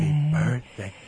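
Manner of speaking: soft breathy happy birthday; Marilyn Monroe breathy voice. Shown as a demonstration. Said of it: "You have to have that breathy voice".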